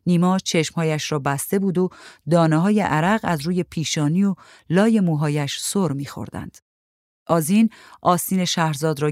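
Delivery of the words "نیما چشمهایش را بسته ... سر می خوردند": ordinary delivery, nothing marked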